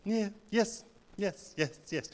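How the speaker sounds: lightly voiced migrant parent, polite yeses; a hint of a parent agreeing without understanding; parents nodding along. Parents nodding at the salesman, soft polite yeses. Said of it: "this is my parents".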